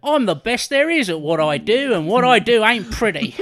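high, loud hammed-up Australian growl; Aussie Wolverine. Quoting Wolverine's catchphrase in a loud mock Australian voice. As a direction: boom the catchphrase as a cartoon Aussie.

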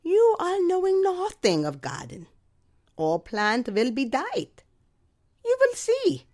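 high, loud Eastern European neighbor scolding; scolding Eastern European accent. Retold or imitated. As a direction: taunt her in a shrill-free high Eastern European voice. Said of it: "in her indeterminate Eastern European accent".

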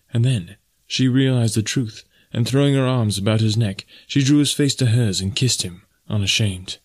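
ordinary delivery, nothing marked

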